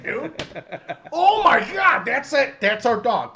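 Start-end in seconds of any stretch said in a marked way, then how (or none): none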